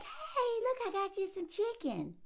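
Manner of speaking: high, chirpy eager wife voice; so happy. Retold or imitated. Wife showing off chicken to husband, mock happy high voice. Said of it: "Like, so happy".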